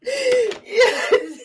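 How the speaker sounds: high, squealing real laughter; laughing. Real, not acted. Streamer enjoys a viewer's comment about tough streets, helplessly laughing. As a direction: dissolve into laughter, squeak out the word. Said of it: "I'm laughing at that".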